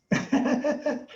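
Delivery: high-pitched chuckle; short burst of amused giggling; laugh. Real, not acted. Amused while telling the story.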